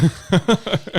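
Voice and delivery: high, loud burst of laughter; laughing. Real, not acted. Joker picturing a toucan child, high laughing fit. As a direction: crack up loudly at your own joke.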